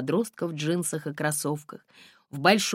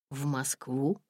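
bewildered; 6 dB quieter, slower than usual. A narrator's reading. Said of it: "растерянно переспросил он".

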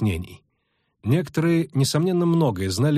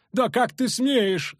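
high, slow, cracking matron's cry; brittle shout; 11 semitones higher, much slower than usual. A narrator's reading. Matron demanding respect from the bandit, high cracking cry. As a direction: cry out in a breaking old voice. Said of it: "завопила Матрона".

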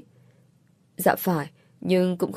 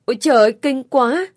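higher and louder cry of disgust; 5 semitones higher, 5 dB louder, faster than usual. Acted. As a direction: recoil at the taste, crying out. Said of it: "cô đã bụm miệng kêu lên".

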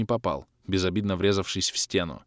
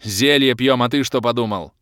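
grumbled; 3 semitones higher, 7 dB louder than usual. Acted. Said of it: "буркнул я".

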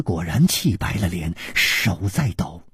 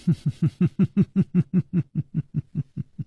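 a long laugh that drops away; falling laugh; 6 semitones higher than usual. A narrator's reading. The woman laughs off Su Rongrong's question, laughter falling away. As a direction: laugh it off, sliding down.

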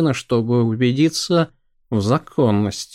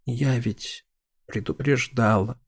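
soft resigned sigh; 9 dB quieter than usual. Acted. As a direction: sigh the words out quietly. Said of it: "вздохнула Доротея". (со вздохом, тихо)

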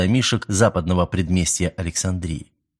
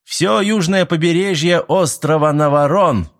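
cheerful; 9 semitones higher, 4 dB louder than usual. A narrator's reading. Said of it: "жизнерадостно произнёс Дженсен".

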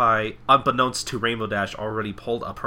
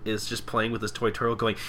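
ordinary talk

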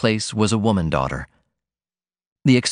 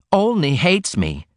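put-on slow, raised, heated young voice; mild passionate exclamation to mother; passionately; 8 semitones higher, slower than usual. A narrator's reading. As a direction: spit out the dislike with feeling. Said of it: "she exclaimed passionately".

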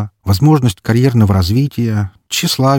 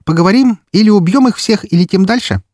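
put-on cheerful girl voice; 12 semitones higher, 4 dB louder than usual. A narrator's reading. Foxy keeps same merry voice.

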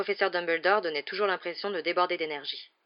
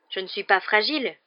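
louder, indignant protest; angry exclamation; 3 semitones higher, 7 dB louder than usual. Acted. Harry objecting to the nurse, angrily. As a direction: snap back, stung and angry. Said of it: "s'exclama Harry avec colère".

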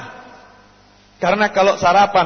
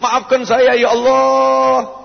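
higher, drawn-out plea for forgiveness; pleading prayer; 7 semitones higher, 4 dB louder, much slower than usual. Acted. Preacher acting a penitent begging God's pardon, pleading. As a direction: draw it out as a cry to God.

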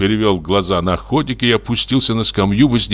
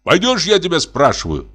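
high-pitched angry demand; angry shout; 10 semitones higher than usual. A narrator's reading. Hunter presses the forester for an answer, angry shout. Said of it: "крикнул охотник, злобно тараща глаза".

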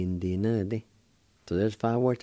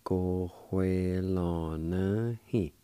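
Cojuelanahi very slowly, syllables stretched and joined; slow pronunciation demo; much slower than usual. Shown as a demonstration. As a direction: draw out every syllable of Cojuelanahi. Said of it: "It's pronounced like this".

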